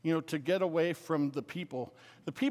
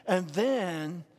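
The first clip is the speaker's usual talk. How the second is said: put-on louder kid bursting to tell the next bit; breathless-to-tell child, lightly imitated; excitable kid; 4 dB louder than usual. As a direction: say it like an eager kid telling a story. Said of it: "Kids sound like that".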